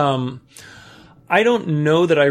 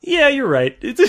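high, laughing grudging agreement; laughing concession; 14 semitones higher, slower than usual. Retold or imitated. And I'm like.

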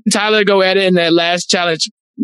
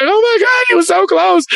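high-pitched mock wail of sympathy; hammed-up heartbroken cry; retold tearful crying; 10 semitones higher than usual. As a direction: act out crying for someone, voice pitched way up. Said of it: "I'm crying for Tyler".

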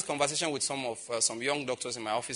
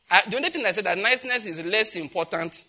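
ordinary talk, nothing marked

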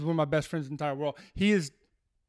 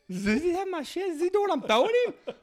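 alarmed; 12 semitones higher than usual. Retold or imitated. Retold alarmed question to teammate.

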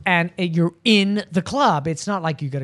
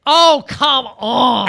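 drawn-out, louder and higher objection; loud protest; 5 semitones higher, 6 dB louder, much slower than usual. Real, not acted. Co-host scoffs at the question of the day, loud protest. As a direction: stretch it out in loud disbelief.

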